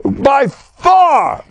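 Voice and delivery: a ringing emphatic shout; emphatic high call. Real, not acted. Emphatic exclaim in shiur.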